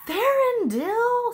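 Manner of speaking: high, very loud exasperated call; shouted. A narrator's reading. Princess yelling at the charging knight, full shout. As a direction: yell the name across a distance. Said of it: "Cimmerian shouted".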